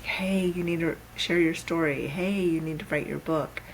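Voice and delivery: ordinary talk, nothing marked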